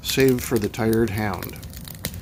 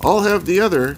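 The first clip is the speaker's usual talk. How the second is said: lightly voiced aristocrat, pitched high; raised, hint of aristocratic manner; 7 semitones higher, 5 dB louder, faster than usual. A narrator's reading. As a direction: call it out like an aristocrat. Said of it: "aristocratic voice from the opposite direction".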